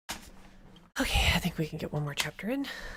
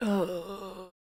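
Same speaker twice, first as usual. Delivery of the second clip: tired; much slower than usual. Real, not acted. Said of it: "tired, guys".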